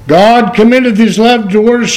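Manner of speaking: ordinary talk, nothing marked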